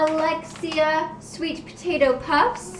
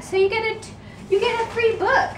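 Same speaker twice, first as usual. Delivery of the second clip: excited; 8 semitones higher, faster than usual. Real, not acted. Excited about free book.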